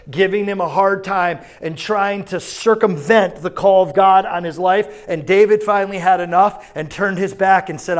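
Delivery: loud, high, driving sermon; full-force preaching. Acted. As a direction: preach it hard and loud to the crowd. Retelling David at full preach.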